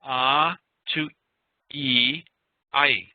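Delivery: ah gliding through E into I, each sound held apart; vowel glide demo. Shown as a demonstration. Teacher modelling the "I" glide for students, slow and clear. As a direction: model "ah" then "E" then "I" so the class can copy. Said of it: "You go from".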